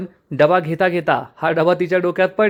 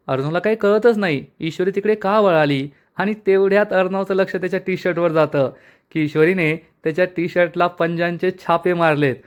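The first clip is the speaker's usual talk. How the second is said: ordinary delivery, nothing marked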